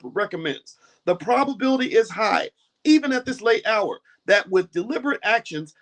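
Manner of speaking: measured formal book reading; reading aloud. Acted. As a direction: read the passage out steadily. Reads book passage aloud.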